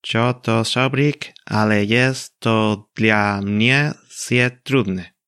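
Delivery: slow, clumsy broken Polish; imperfect Polish. Shown as a demonstration. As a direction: read Polish badly, slowly. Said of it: "Leerlo en un idioma que desconozcas".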